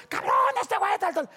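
very high, shouted self-defense; heated protest. Retold or imitated. Speaker defending himself to the buddies, high indignant shout. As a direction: act out an indignant protest in a brawl.

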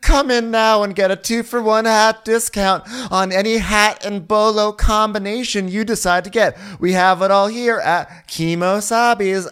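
announcer-style sales pitch; mock store advert. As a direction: pitch the shop like a hammy commercial.

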